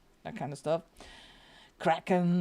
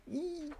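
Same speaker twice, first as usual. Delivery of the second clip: excited squeal; 10 semitones higher, 7 dB quieter than usual. Real, not acted. Squealing at eerie frogs.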